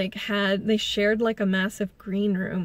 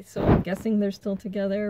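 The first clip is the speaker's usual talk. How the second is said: ordinary talk, nothing marked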